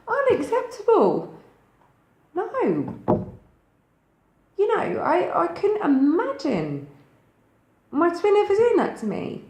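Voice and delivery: high, loud disbelieving outburst; incredulous outrage. Real, not acted. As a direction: scoff with laughs at the very idea. Outraged at imagined abuse by partner.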